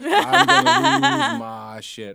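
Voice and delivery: high, loud, slow laughing squeal; excited. Retold or imitated. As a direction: burst out overwhelmed with joy. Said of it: "and I was like".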